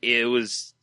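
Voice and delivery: normal talking voice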